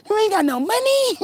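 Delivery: mock scornful shout. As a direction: jeer at the broke date. Mocking a broke date.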